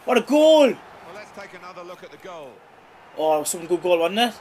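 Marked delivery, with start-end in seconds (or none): 0.1-0.7 loud, high cry of celebration; jubilant shout. Real, not acted. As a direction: holler it in triumph after the goal. Celebrating the goal on replay.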